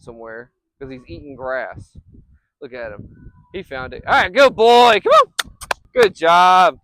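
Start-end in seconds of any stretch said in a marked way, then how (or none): 4.1-6.8 high, loud praise call. Real, not acted. Owner praising his dog loudly across the field. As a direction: cheer him on as he comes back.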